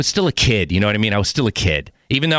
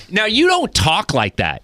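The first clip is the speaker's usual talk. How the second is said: ordinary delivery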